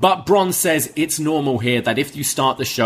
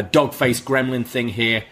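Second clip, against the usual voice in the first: ordinary delivery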